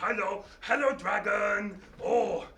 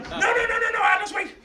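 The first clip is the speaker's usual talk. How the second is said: high, loud rapid nos; frantic no; 13 semitones higher, 8 dB louder than usual. Real, not acted. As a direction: stop her in a hurry. Stopping the child.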